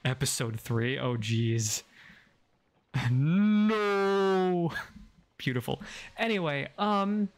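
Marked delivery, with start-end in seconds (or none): 3.1-4.7 anguished movie-villain "no", high and long; hammed-up drawn-out cry of despair; Lord Vader. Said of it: "I sound like Lord Vader".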